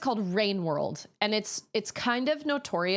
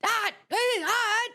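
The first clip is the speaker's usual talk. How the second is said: very high, strained, broken-off grunting; squeaky, tongue-tied Link cry; Link's strained grunt; much slower than usual. Retold or imitated. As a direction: squeeze out each word like a struggling Link. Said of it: "all Link can say is".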